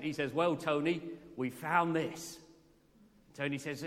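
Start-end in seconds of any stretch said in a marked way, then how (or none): 0.3-2.4 put-on slow, lightly done Norwich accent; a hint of a Norfolk drawl, imitating the archaeologist. Archaeologist Phil answering presenter Tony, in a light Norwich accent. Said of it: "with his Norwich accent".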